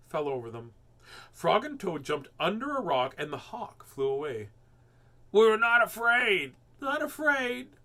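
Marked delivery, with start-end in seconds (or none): 5.3-7.8 loud, high, slow scream of false bravery; terrified denial, much louder and higher; scared scream. A narrator's reading. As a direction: scream that you're not afraid. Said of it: "screamed Frog and Toad".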